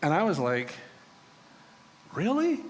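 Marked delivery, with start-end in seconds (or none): 2.1-2.6 sharply higher, disbelieving "Really?"; mock disbelief at the crew's plan; incredulous. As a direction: scoff at a silly idea. Reacting to drawing straws.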